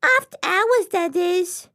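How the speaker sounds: tiny officious fish; small fish voice. Acted. Small fish character speaks.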